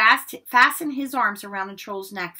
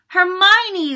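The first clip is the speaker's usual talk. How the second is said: high, loud cry of horror; alarmed cry; 10 semitones higher, 9 dB louder than usual. A narrator's reading. Two boys realising their friend is trapped, alarmed. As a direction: cry out the name in dismay. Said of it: "they said together".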